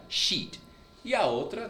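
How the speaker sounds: ordinary talk, nothing marked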